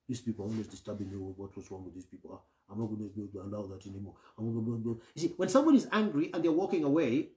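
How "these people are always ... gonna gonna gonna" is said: fast, much softer grumbling under the breath; resentful mumbling. Retold or imitated. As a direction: mutter the grudge to yourself, low and quick. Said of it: "That's negative meditation".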